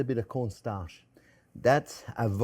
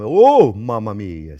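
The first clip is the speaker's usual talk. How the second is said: loud, drawn-out cry of dismay; startled exclamation; 11 dB louder, much slower than usual. Real, not acted. Cook interrupts his own tip over a mishap, loud dismay. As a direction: blurt it out long and loud. Spill while sprinkling cheese.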